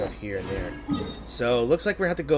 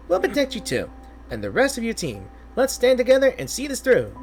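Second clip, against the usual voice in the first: bright squeaky sidekick, much higher; high excitable companion voice; 9 semitones higher, 4 dB louder than usual. Acted. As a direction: chirp it up like a tiny mascot. Streamer voicing the excitable companion.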